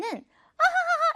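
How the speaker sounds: high, put-on ha-ha laugh; demonstrated laugh out loud. Shown as a demonstration. Teacher shows children what laugh means, mock audible laugh. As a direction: act out laughing aloud, pitched high. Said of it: "이렇게 소리 내서".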